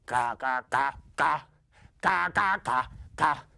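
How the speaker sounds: short, punchy, rhythmic caws; crow cawing. Acted. Papa Crow on his mission to the palace, rhythmic cawing. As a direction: caw out a beat in short bursts.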